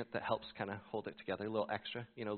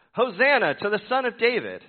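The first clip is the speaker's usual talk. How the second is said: much higher, much louder joyful cry; shouting children; 11 semitones higher, 15 dB louder than usual. A narrator's reading. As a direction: call it out like excited children.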